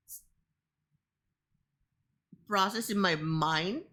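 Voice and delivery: normal talking voice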